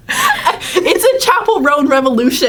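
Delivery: high, slow, laughing through it. Real, not acted. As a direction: laugh while you say it. Delighted exclaiming about [name].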